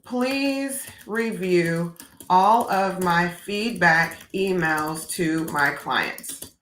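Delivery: slow, careful dictation to an assistant; slow, deliberate voice-command dictation; dictating slowly. Shown as a demonstration. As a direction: read the request out word by word. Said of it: "I'm actually gonna ask that".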